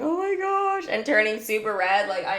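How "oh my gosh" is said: high, flustered mock outburst; mock embarrassed exclamation. Streamer imitating herself getting embarrassed, high mock fluster. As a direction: imitate your own flustered reaction, pitched way up.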